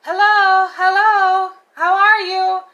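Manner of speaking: high, loud, overeager hellos; mimicking the guy's loud insistent greeting; imitating pushy hellos. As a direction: sing out hello like a too-friendly stranger. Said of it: "He kept saying hello".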